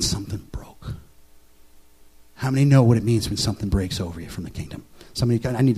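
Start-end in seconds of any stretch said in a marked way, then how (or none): 0.0-0.9 whispered reveal. Acted. Moment of release retold.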